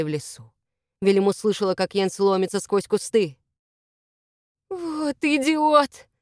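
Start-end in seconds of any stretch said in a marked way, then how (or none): 4.7-6.1 slow, high groan of dismay; exasperated moan. A narrator's reading. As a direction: moan it out, drawing the words long. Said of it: "простонала Велиму".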